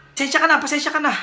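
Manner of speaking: ordinary talk, nothing marked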